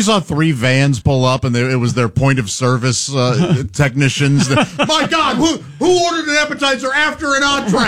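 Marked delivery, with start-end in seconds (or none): 4.9-7.9 shocked shout. Pretend. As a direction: burst in shouting in disbelief. Technicians barging in, shouting.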